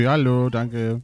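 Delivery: ordinary talk, nothing marked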